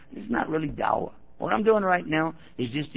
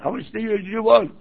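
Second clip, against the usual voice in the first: pitched-up urgent questions, loud at the end; frantic offer; 8 semitones higher, 4 dB louder than usual. Retold or imitated. Panicked driver pleading to pay for the car, pitched up. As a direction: plead to settle it fast.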